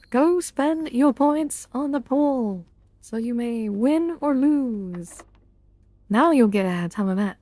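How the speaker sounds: ordinary talk, nothing marked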